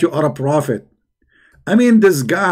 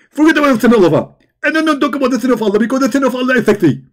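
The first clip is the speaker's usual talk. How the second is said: rushed Zakir Naik; 7 semitones higher, much faster than usual. Retold or imitated. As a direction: rattle it off like a rushed preacher. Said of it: "can you speak slower?".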